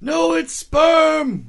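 high, slow shrieking insistence; shouting doctor. Pretend. Doctor insisting on milk jug.

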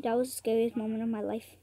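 usual speaking voice

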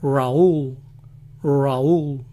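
accented Raúl, American accent. Shown as a demonstration. Showing how Americans still say Raúl, American accent. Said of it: "lo dicen aún con acento".

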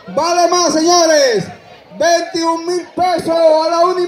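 auctioneer. Acted. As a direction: drag out the going once, twice. Auction calling bids.

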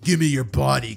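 loud monster voice; put-on villainous creature shout; menacing sludge monster. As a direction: demand it like a hungry monster. Sludge monster demands a body.

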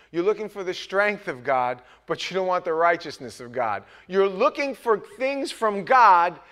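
loud, high, rising sermon cry; impassioned pulpit build; full-force preaching. Acted. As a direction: preach it hard to the congregation.

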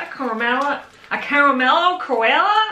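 mock Australian accent, rising on Koala. Claiming to be from Australia, she fakes the accent. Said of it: "I'm from Australia".

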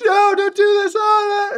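put-on shrieking high child's wail, loud; imitating a sobbing child's high scream; bawling kid. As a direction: howl it like a kid losing his PlayStation. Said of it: "just bawling".

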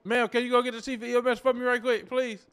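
loud, pushy politeness; loud urgent request. Acted. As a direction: call out loudly for the boss, still polite. Urgently asking staff for the chief.

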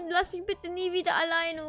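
usual speaking voice

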